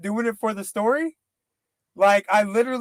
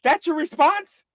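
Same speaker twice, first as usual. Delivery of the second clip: incredulous; 7 semitones higher than usual. Real, not acted. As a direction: react like you can't believe that reply. Outraged disbelief at [name].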